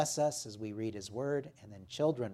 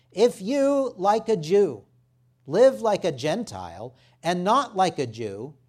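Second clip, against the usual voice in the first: raised, emphatic public Bible reading; scripture reading; 5 semitones higher, 7 dB louder than usual. Real, not acted. Preacher reading Galatians to the congregation, emphatic scripture reading. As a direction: proclaim the passage, pitched up and driven home. Reads the scripture passage aloud.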